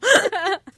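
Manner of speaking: high laugh climbing at the end; weird pitchy laugh. Real, not acted. Co-host laughing at being consoled, weird and pitchy. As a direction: laugh that goes really pitchy. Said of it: "a weird laugh for me".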